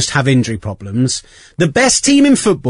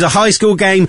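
normal talking voice

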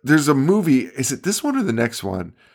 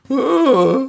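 mock dopey Pinhead; 12 semitones higher than usual. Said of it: "It's just like".

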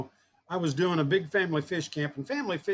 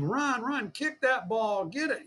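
imitating an urgent parent, pitched higher; mock sideline screaming; 5 semitones higher, slower than usual.

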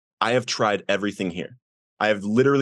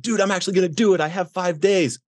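much higher, thrilled retelling; retold excitement; 8 semitones higher than usual. Telling his friend at breakfast about five days clean, excited. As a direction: burst out with proud news, pitch way up. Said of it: "I'm all excited".

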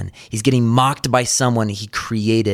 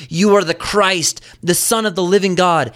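high, louder acted proclamation; great confession; 8 semitones higher, 4 dB louder than usual. Peter makes his great confession, acted proclamation. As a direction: declare it with emphatic conviction, as a retold quote. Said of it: "who made the great confession".

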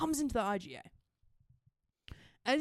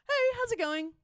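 high-pitched, super-nice greeting; 13 semitones higher than usual. Retold or imitated. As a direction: greet him sweetly, pitched way up. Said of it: "Just, like, super nice".

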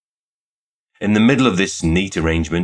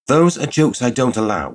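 higher-pitched, stiffened reproof; stiff rebuke; 7 semitones higher, 4 dB louder than usual. A narrator's reading. Shop girl rebukes a sudden proposal, stiffly. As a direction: put the joker firmly in his place. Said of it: "stiffened suddenly and said".

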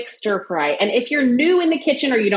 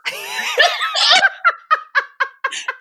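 high squealing laugh. Real, not acted. Hysterical laugh at own story.

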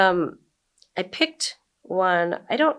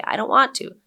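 higher, louder mock whine; imitated whine; 6 semitones higher, 5 dB louder than usual. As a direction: lightly imitate a whining kid. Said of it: "my kids would whine".

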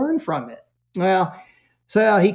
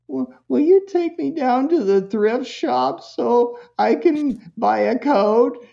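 put-on higher, slow, timid kid asking; meek kid voice; 8 semitones higher, slower than usual. Son asking mom to drive him to the thrift shop, meek high voice. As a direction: ask slowly and sheepishly like a chastened son. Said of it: "now he's talking like this".

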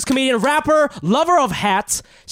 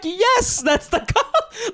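exasperated yes; much slower than usual. Retold or imitated. Indignant comeback replayed through laughter.

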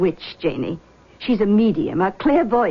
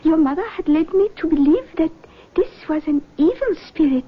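lightly voiced mystic, higher pitch; seance medium; 7 semitones higher than usual. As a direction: greet them like a gracious clairvoyant. Medium senses spirit.

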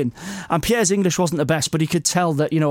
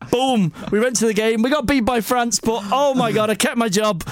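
higher, elated finish to the story; triumphant; 6 semitones higher than usual. Real, not acted. Telling the podcast hosts he kept his job, triumphant. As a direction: punch the air as the story ends.

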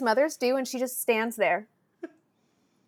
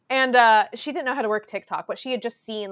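usual speaking voice

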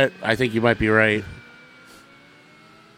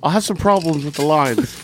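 lightly put-on British accent, quoting the actor; 3 semitones higher than usual. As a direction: mimic the actor's British accent after the cut, half-hearted. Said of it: "and he's talking like this".

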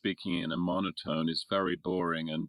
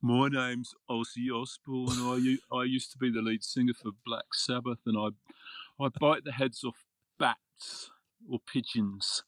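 [name]; 3 semitones higher than usual. Retold or imitated. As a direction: ham up a Brummie rock star, too clearly. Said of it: "one of the worst accents in the world".

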